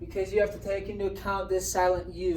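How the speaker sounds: ordinary delivery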